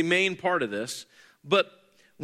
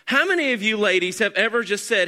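ordinary delivery

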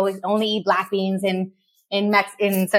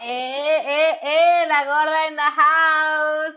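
high, drawn-out hype call; hype entrance; 6 semitones higher, much slower than usual. Real, not acted. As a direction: announce yourself like a star walking in. Guest announces own entrance.

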